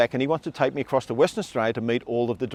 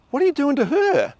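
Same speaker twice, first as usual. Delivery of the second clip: high, shocked demand of what's happening; startled accusing question; 12 semitones higher than usual. Retold or imitated. Boss waking up to a strange sight, shocked and accusing. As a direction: jolt up in pitch, accusing.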